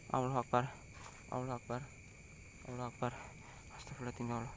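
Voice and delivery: hushed, breathy muttered prayer; whispered prayer. Real, not acted. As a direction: murmur it under your breath, scared. Frightened prayer in the dark.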